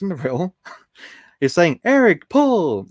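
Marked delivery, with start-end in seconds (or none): 1.8-2.9 slow, higher, louder advert announcer. Pretend. Streamer reads names like an advert announcer. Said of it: "it's like an advert".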